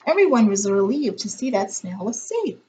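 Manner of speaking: usual speaking voice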